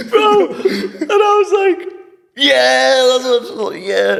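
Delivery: can't stop laughing as you speak; laughing through words. Real, not acted.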